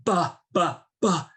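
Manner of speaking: back-of-throat placement. Shown as a demonstration. Teacher showing students throat placement, throaty voice. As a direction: push each ba back into the throat. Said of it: "put it back into our throat".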